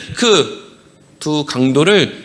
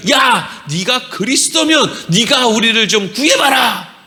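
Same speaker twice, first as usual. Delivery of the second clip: acted shouting; slower than usual. Robber yelling at Jesus to save them, acted shout. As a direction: call out a taunting demand. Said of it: "이러고 소리를 쳤다는 거예요".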